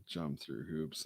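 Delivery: normal talking voice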